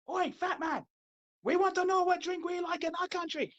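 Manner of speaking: high, put-on heckler voice; mock offended Germans. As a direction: heckle in a high, badly faked German accent. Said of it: "They get so offended saying".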